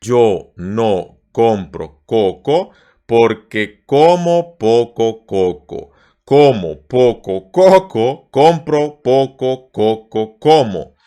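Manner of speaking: slow, careful syllables; slow tongue twister. Shown as a demonstration. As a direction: say each coco slowly. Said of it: "is slow first".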